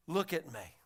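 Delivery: soft, low closing answer; quiet invitation. Acted. Jesus showing what truth is, soft and low. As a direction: bring it down to a hush.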